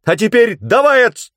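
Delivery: very high, loud, cut off mid-word; urgent shout. A narrator's reading. Tananda urges Skeeve on before being struck, urgent shout. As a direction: scream the order and break off suddenly.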